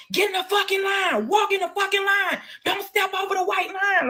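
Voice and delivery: high, slow barking shout of a drill sergeant; drill sergeant yell. Retold or imitated. Guards yelling at new inmates, high slow drill-sergeant shout. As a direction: bellow orders at new inmates. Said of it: "they yelling at you like drill sergeants".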